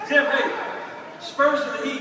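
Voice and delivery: loud, high call across the room; hey, grab his attention from across the stage. Real, not acted. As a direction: shout the question to him. Host calling out to guest.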